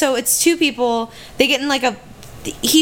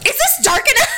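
mocking laugh; 13 semitones higher, faster than usual. Mocking viewer at drill.

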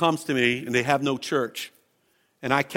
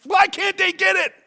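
high, loud frustrated cry; exasperated yell; 3 dB louder than usual. Retold or imitated. Pastor retelling his frustration at the TV preachers, high and exasperated. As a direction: throw up your hands at the TV. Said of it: "I said".

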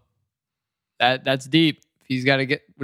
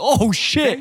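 a high, loud "oh, shit" at a big hit; excited shout; 10 semitones higher, 4 dB louder than usual. Real, not acted. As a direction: yell it at the knockdown punch.

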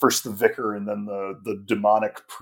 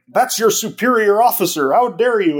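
higher and louder, imitating a scolding Doctor; mock stern rebuke; 8 semitones higher, 6 dB louder than usual. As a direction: scold her like an indignant superior. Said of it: "the Doctor turns on her and is like".

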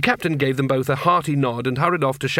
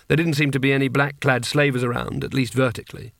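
ordinary delivery, nothing marked

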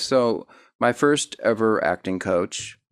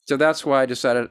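ordinary talk

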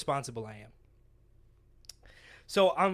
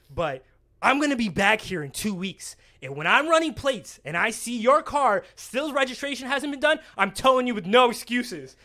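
stern cop; 5 semitones higher, 5 dB louder than usual. Retold or imitated. Officer warning a driver he let off, stern and deliberate. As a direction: hammer each condition home as the officer.

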